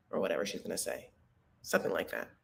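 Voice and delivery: whispered afterthought; throwaway murmur; hushed aside. Real, not acted. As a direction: drop to a whisper. Trailing aside.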